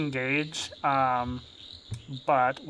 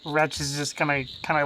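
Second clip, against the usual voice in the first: normal talking voice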